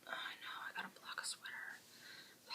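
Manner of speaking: unvoiced whisper confession; guilty whisper about blocking. Real, not acted. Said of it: "whispered admission".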